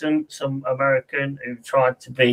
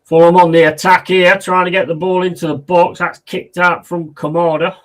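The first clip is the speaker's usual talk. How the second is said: higher, louder football commentary; live play-by-play; 5 semitones higher, 8 dB louder than usual. Real, not acted. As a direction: call the attack as it happens, voice lifted.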